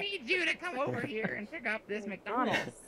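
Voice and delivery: high-pitched mock mom voice; put-on high woman voice; imitating a woman. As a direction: play his mother calling him over in falsetto. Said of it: "whenever you're trying to imitate a woman".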